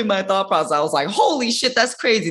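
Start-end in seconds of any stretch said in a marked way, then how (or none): 1.1-2.3 high-pitched retold amazement; mock-stunned exclamation. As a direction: re-enact being floored, pitched way up. Retelling her stunned thought process.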